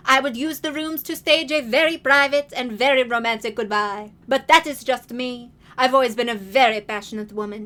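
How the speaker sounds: put-on loud, grand old lady; Grandmère voice. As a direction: lay it on like a dramatic grandmother. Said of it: "Grandmère said".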